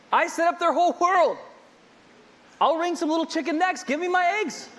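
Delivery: hammed-up indignation climbing to a pitched demand; mock outrage. Preacher playing a furious chicken owner, hammed-up rising anger.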